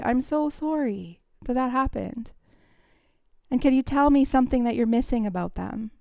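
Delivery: softly consoling someone who lost a loved one; softened voice. Shown as a demonstration. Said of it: "we lower our voice, we soften our voice".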